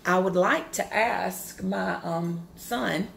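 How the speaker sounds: ordinary talk, nothing marked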